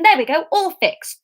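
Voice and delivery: triumphant. A narrator's reading. Emma thinks she has solved it, triumphantly. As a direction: declare it sorted in triumph. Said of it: "thought Emma triumphantly".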